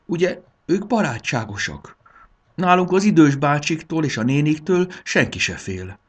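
put-on high, pleading child's voice; whimpering. A narrator's reading. Bori hopes the old dragons are friendly, whimpering. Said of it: "pityergett Bori".

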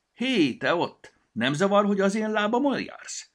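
high, loud indignant scolding; a tiny elf's high, loud telling-off; indignant shout. Acted. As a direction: call out at a rude stranger. Petya scolds the stranger.

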